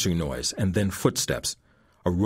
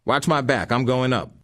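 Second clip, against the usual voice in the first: higher, louder tough voice; intruder voice; 7 semitones higher, 5 dB louder than usual. A narrator's reading. Thug telling his partner to cover him, loud. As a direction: warn your partner before climbing.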